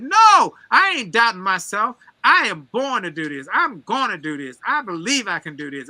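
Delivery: a loud, high burst of No easing into firm belief; defiant declaration. Retold or imitated. Answering the doubting friend with a loud defiant No, then firm. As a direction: shout down the doubter, then settle into conviction. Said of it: "I said".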